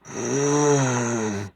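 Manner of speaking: long, high, loud groan; groaning out of the dark. A narrator's reading. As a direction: let out a drawn-out groan. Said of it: "A groan suddenly cut through".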